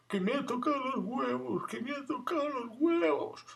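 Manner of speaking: voz muy aguda de niño pequeño quejoso; niño lloroso. Retold or imitated. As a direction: imita a un crío lloriqueando. Niño sale llorando del médico. (niño que sale de la enfermería contándolo con voz chillona)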